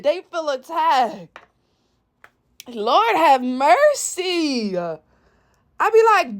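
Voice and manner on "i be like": usual speaking voice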